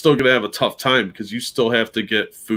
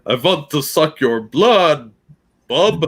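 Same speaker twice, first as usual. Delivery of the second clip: put-on slow, higher Romanian vampire accent with a Canadian bub; mock Romanian vampire; 5 semitones higher, 4 dB louder, slower than usual. Joking about a streamer's accent, slow Romanian vampire voice. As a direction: drawl it like a Transylvanian count. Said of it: "that Romanian kind of accent".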